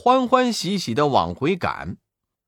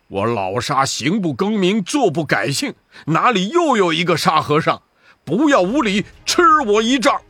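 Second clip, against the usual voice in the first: furious, building to a battle cry; 3 semitones higher, 4 dB louder than usual. Acted. Sha Seng facing the fake Sha Seng, furious shout. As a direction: build the anger until the strike. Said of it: "大怒".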